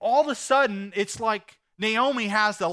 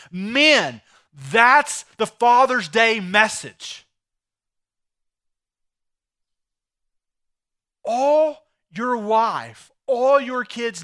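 louder, slow and weighty; emphatic preaching; 3 semitones higher, 8 dB louder, much slower than usual. Real, not acted. Pastor addressing fathers, loud and slow. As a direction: hammer the point home to the men. Pastor driving home the sermon point.

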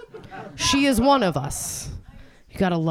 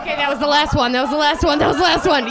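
building excitement; 5 semitones higher, 4 dB louder, faster than usual. Real, not acted. Wrapping up, building to cheers.

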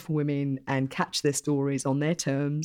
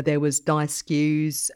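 ordinary talk

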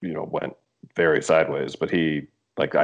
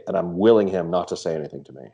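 normal talking voice

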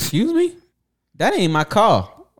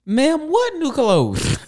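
incredulous; 9 semitones higher, much slower than usual. Real, not acted. Mock disbelief at made-up quote.

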